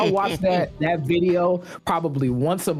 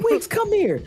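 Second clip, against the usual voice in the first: very high, urgent summons; re-enacted call-over. Bench veteran summoning Wiggs, high urgent imitation. Acting Iguodala calling Wiggins over.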